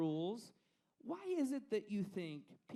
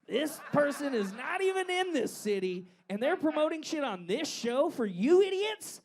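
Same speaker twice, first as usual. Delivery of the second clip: louder, higher gloating brag; mock boastful rant; 7 semitones higher, 7 dB louder than usual. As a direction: boast to the crowd with fake arrogance. Mock boasting, loud high.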